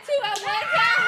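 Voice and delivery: high laughing shout. Real, not acted. Laughing shout after answer.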